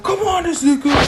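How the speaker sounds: high, loud mock shout; rally your partner, voice way up; acted-out hero yell; urgent battle call. Hero calling to partner mid-fight.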